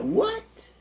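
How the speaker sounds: high-pitched disbelieving "what"; incredulous. Acted. Pastor reacting to a member's gambling, incredulous. Pastor shocked by her answer.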